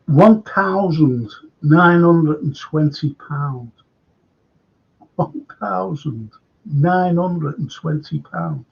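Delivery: slow, drawn-out disbelief; disbelieving. Real, not acted. Host aghast at Real Madrid ticket price, slow disbelief. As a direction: spell out the price slowly, aghast.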